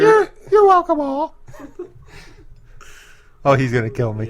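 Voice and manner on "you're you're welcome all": very high falsetto impression, close to laughing; reply in the put-on falsetto; [name]. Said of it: "Thank you, [name]".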